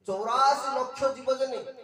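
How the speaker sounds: loud, high, forceful call; emphatic proclamation. Real, not acted. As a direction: drive the number home to the whole assembly. Preacher drives the number home. (ଉଚ୍ଚ ସ୍ୱରରେ ଜୋର ଦେଇ ଘୋଷଣା)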